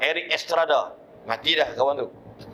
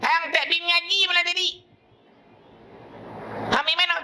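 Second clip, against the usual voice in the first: put-on much higher, sharp scolding old woman; scolding grandmother; faster than usual. Grandmother demanding where the boy went, high scolding. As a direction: interrogate him like an angry granny.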